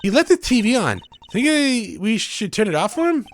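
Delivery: put-on high, bright young magician girl; voicing the girl sidekick, perky and high; cheery girl voice. Voices Trucy in game dialogue.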